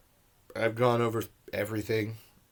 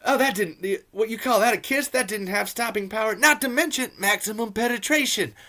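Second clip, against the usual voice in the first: high, strained caricature of a movie character; Bert; 10 semitones higher than usual. Retold or imitated. Reviewer mimicking Bert complaining about a kiss, high mocking character voice. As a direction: rant it in a put-on high voice. Acts out Bert's line from weirdo conversation.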